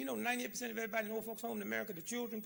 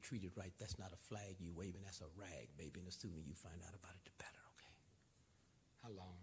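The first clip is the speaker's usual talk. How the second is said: hushed and pointed, almost to himself; quiet whisper with a fast tail; 12 semitones lower, 16 dB quieter than usual. Real, not acted. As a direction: drop to a soft, low whisper. Closing point about the flag.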